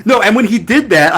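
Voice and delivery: ordinary talk, nothing marked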